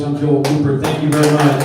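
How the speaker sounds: big closing thank-you; loud sign-off. Real, not acted.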